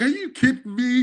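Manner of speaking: high, laughing are you kidding me; laughing disbelief. Retold or imitated. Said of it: "he just started laughing".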